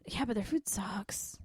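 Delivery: slow whispered complaint. Real, not acted. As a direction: whisper it like a secret. Conspiratorial complaint.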